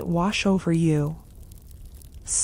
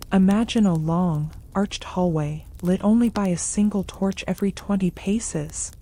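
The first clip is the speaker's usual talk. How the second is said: ordinary delivery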